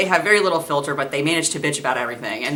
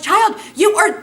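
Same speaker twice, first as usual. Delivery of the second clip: laughing yell; slower than usual. Retold or imitated. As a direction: shout it at the stubborn kid, already cracking up. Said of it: "I'm like".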